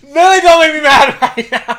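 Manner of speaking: high, loud laughing complaint; laughing pretend annoyance; laughing mock anger. Real, not acted. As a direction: fake mad while cracking up. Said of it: "It's gonna piss me off".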